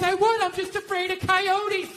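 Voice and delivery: high, slow mock wife voice; wife's excuse. Wife excusing herself from the trash, imitated high voice. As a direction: imitate a wife pleading fear, drawn out. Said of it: "She goes".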